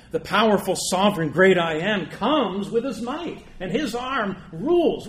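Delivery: loud, rising sermon voice; preaching at full force. Acted. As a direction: thunder out the King's power and judgment. Preaching God's power and judgment.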